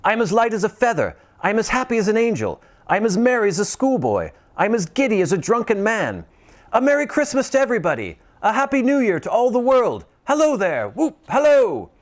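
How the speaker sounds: high, fast, giddy calling out; giddy Scrooge. Acted. Scrooge shouting merry wishes to the whole world, giddy. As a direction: bubble over like a schoolboy.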